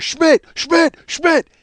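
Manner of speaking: high, loud repeated name-shout; urgent calling out. Retold or imitated. Friend trying to pull him out of a fight, urgent calling out.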